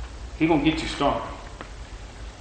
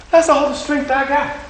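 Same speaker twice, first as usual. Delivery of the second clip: exhausted plea; 11 semitones higher than usual. Retold or imitated. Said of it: "exhausted lifter complaint".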